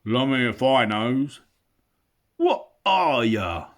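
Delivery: loud, slow oafish troll voice. Acted. Trolls puzzle over the hobbit, slow loud. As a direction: drag it out like a dim troll.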